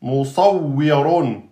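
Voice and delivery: "Musawwirun" slowly, doubled w, heavy s and r; slow model reading; slow demo word. Shown as a demonstration. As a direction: drag out "Musawwirun" syllable by syllable. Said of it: "le waw est aminci entre deux emphatiques".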